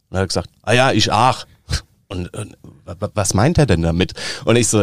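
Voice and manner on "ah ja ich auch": loud, hearty Hessian punchline; caretaker's loud Hessian agreement; Hessian dialect. Acted. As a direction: answer emphatically in broad Hessian. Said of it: "einen Witz auf Hessisch?".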